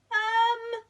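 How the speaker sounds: high, caught-out um; hesitant Norman. Acted. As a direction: stall for an excuse. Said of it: "said Norman".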